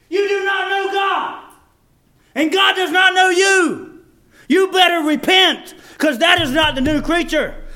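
full-throated, high impassioned exhortation; real fire-and-brimstone intensity; worked-up preaching. Real, not acted. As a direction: drive the warning hard at the congregation. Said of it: "I know I get worked up".

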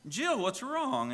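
high, slow, gentle concern; concerned old shopkeeper. Acted. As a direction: ask the little girl kindly what's wrong. Mister Bell's voice in the story.

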